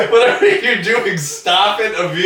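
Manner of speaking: loud high laughing command; laughing shout. Acted. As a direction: yell it while cracking up.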